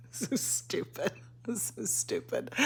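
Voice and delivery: laughing at herself. Real, not acted.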